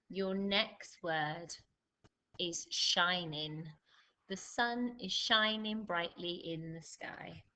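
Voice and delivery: slow, measured word-by-word dictation; spelling-test dictation. Acted. Teacher giving pupils their spelling test, slow dictation. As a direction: read out each test word slowly, with pauses.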